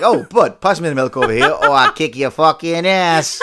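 loud mock mobster threat, slowing at the end; Italian mobster accent. Imitating wife's mobster turn over milk, Italian mobster accent. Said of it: "like an Italian fucking mobster".

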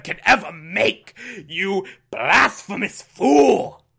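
hammed-up, slow outrage; Commissioner Gordon. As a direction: draw out each shocked word in theatrical indignation. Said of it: "Commissioner Gordon being like".

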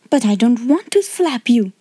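plain reading voice

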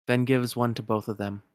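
ordinary talk

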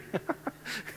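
a soft airy chuckle; quiet huff of a laugh; breathy chuckle. Real, not acted. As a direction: laugh under your breath at your own joke. Chuckle after joke.

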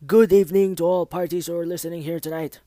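booming news anchor opener; loud anchor greeting. Acted. The host greets his listeners in a loud news anchor voice. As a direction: project the welcome like a big broadcast.

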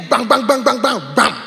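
high, punchy run of bangs; blunt telling-off. Retold or imitated. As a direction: fire the words off like blows. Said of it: "we will just tell you".

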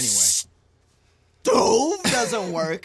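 loud, very slow, stretched on "stove"; hammed-up outrage. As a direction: hang on "stove", then burst out. Mock rage at broken stove.

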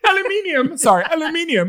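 high British self-correction; mock British accent. Clerk corrects the word for the UK, British accent. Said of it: "I wanna help our people in, uh, in the U K".

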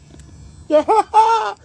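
a sudden high burst of laughter; loud high laugh. Real, not acted. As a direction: crack up loudly at the joke.

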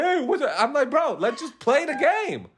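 a laughing bro impression; imitated friends. Retold friends and own reply.

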